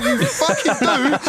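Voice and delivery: very high, drawn out, half-laughing; exasperated retold accusation. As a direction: stretch the acted accusation out, pitched right up. Acts out final accusation, laughing.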